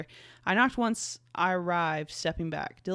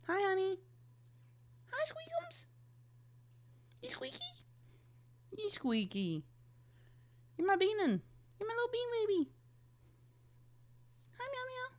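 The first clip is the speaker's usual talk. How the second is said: very high, soft squeaky coo; 7 dB quieter than usual. Real, not acted. Owner cooing at her little cat in baby talk. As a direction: coo tenderly at the kitten.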